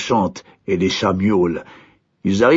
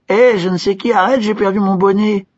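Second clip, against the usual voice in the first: cri haut et fort; crier; 11 semitones higher, 3 dB louder than usual. A narrator's reading. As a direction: crier dans le vent. L'idiot crie en volant. (l'idiot crie au serviteur en plein vol)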